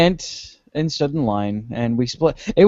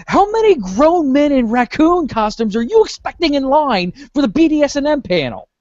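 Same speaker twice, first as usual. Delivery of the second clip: said out loud; 8 semitones higher than usual. Retold or imitated. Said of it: "And I say out loud".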